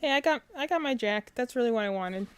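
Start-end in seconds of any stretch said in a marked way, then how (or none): none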